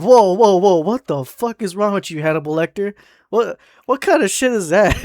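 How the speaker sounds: appalled disbelief. Retold or imitated. Worker to coworker after glue gun threat, appalled. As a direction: cut him off, aghast and half amused. Retells horrified reply to coworker.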